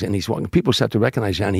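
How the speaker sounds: usual speaking voice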